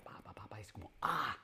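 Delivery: whispered pa pa pa; whispered chatter. Shown as a demonstration. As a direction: whisper the babble, then a sigh. Friend who never stops talking. (susurro de parloteo)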